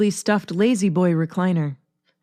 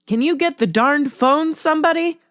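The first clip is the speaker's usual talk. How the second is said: high, annoyed call across the room; irritated yell; 8 semitones higher than usual. A narrator's reading. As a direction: snap at the family over the ringing phone. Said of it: "gets angry at the mere thought".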